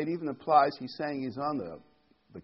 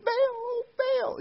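imitating prophets shrieking much higher; mock frantic yell; 4 dB louder than usual. Preacher imitates Baal prophets calling, high mock yell. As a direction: cry Baal like frenzied prophets. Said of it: "they were yelling and screaming".

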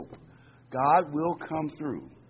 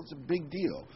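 normal talking voice

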